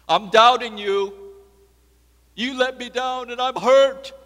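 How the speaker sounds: loud, high, acted accusation; hurt protest. Doubter telling God of the hurt, acted loud protest. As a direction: cry it out as a wounded complaint.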